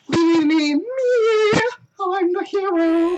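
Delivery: high, slow, whiny self-important hero; hammed-up vain hero. Reads vain character lines.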